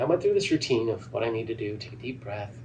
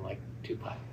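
softer whispered pep talk to himself; whispered self-talk; 4 semitones lower, 14 dB quieter than usual. Retold or imitated. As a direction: whisper the plan to yourself. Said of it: "and I'm like".

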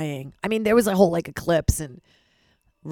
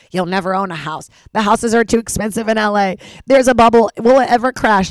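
louder and faster, building panic; panicked spiral; 4 semitones higher, 10 dB louder than usual. Retold or imitated. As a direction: spiral into frantic worry. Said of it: "went into a spiral".

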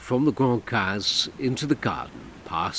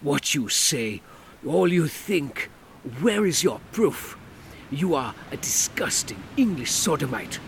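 furious Creole man; 6 semitones higher than usual. Acted. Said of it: "he exploded".

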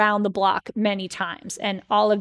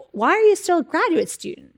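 higher, louder puzzled ask; incredulous question; 9 semitones higher, 4 dB louder than usual. Retold or imitated. Friedman asking Schwartz, incredulous high voice. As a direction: sound baffled she never finished. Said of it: "And he's like".

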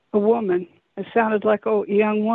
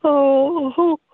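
high wailing oh sounds, much higher than usual; imitating mournful creature weeping; 5 semitones higher, 3 dB louder, much slower than usual. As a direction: mimic a wild creature's human-like weeping wails, not quite real. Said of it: "I'll give you an idea".

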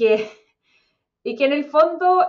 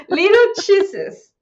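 put-on slow, higher Colombian-accented English through laughter; 7 semitones higher, 5 dB louder, much slower than usual. Podcaster imitates Gloria's Colombian accent, laughing. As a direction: drawl it like Sofía Vergara, giggling. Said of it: "En un capítulo ella ordena".

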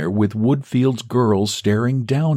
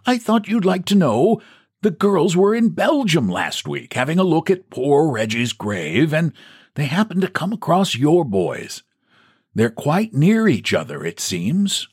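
put-on higher old man's voice, mild and kindly; an old clerk passing on news; old Woodfield, gentle and chatty; 9 semitones higher than usual. Old clerk telling boss about the graves, gently.

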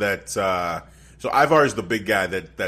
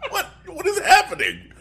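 high, laughing disbelief; baffled outburst, voice leaping up; incredulous; 3 dB louder than usual. Retold or imitated. Reliving shock at ad.

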